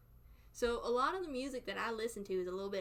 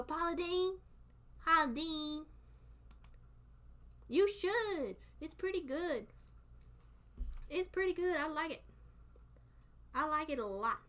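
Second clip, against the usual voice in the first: mildly put-on excited kid voice; childhood self; 6 semitones higher than usual. Recalling gushing over a TV chef as a kid, excited kid voice. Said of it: "when I was a kid, I'd go".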